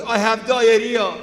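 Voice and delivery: hammed-up Iranian victim proclaiming the ailment; biggest victim ever. Officer asks friend what's wrong, loud mock victim wail. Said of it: "turn into the biggest victims ever".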